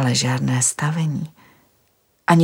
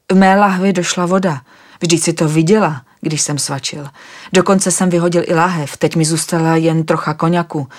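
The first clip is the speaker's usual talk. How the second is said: louder, upset and pleading for water; thirsty, tired man getting worked up; rising agitation over having no water; agitated; 5 dB louder than usual. Acted. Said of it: "Když viděla mé rozrušení".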